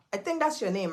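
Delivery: normal talking voice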